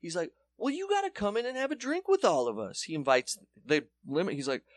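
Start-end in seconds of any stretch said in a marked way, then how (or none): 0.6-2.8 much higher, sing-song imitation of friendly players; high mock-enthusiastic welcome; gushing Globetrotters. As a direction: talk to him like an excited kid. Inviting him in.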